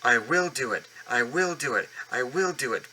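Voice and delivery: steady chanting effort; determined puffing. Acted. Edward pushing from behind, determined chant. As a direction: push each phrase out with grit. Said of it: "puffed Edward".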